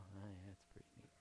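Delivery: low, soft, fast throwaway reply; quick low half-hearted mumble; unimpressed mumble. Retold or imitated. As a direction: shrug it off, barely bothering. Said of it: "and I go".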